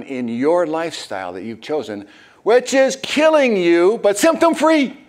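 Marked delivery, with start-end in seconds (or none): 2.4-5.0 high, loud mocking sing-song; mock the fake cure with a squeaky voice; mock sales pitch. Preacher mocking a symptom-free lifestyle, squeaky and loud. Mocking the quick-fix promise.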